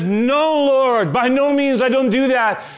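high, loud, slowed no; loud adamant refusal. Acted. Peter refusing God's command, loud and high. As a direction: refuse with full force.

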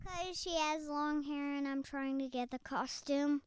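normal talking voice

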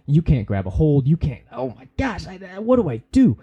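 rising, hammed-up helplessness; mock panic. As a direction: play someone frantic and at a loss. Acts out an overwhelmed person.

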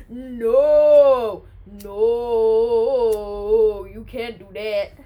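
long, drawn-out loud "no"; hammed-up cry of objection; mock dramatic shout. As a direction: burst in bellowing a protest. Acting out his shouted interruption.

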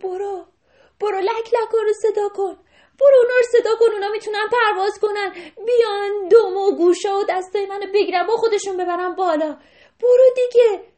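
high, loud urgent orders; urgent shout. Acted. Rabbit shouting urgently at the deer to fetch storks. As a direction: yell at the deer to hurry. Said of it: "خرگوش داد زد".

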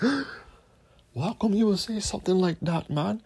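mocking a shocked believer. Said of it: "Y'all be like".

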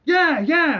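high, loud eager yeah; eager astronauts. Retold or imitated. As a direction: jump at it. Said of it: "they're like".

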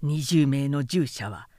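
usual speaking voice

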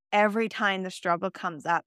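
ordinary delivery

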